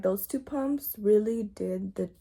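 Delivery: ordinary talk, nothing marked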